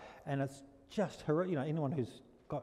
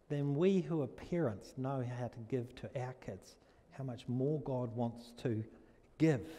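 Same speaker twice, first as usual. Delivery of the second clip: quieter, slower pleading; tender hush over the congregation; soft intimate preaching; 4 dB quieter, slower than usual. Acted. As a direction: draw them in gently. Preaching softly.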